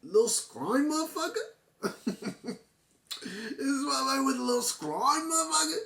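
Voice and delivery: squeaky, giggling "are you serious?"; laughing disbelief. Retold or imitated. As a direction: crack up at how absurd it is. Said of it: "I was like".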